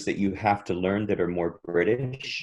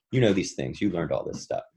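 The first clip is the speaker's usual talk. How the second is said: ordinary talk, nothing marked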